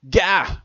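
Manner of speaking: short, high, loud yelp; sudden yelled cry; startled yell. A narrator's reading. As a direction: yelp in alarm. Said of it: "I yelled".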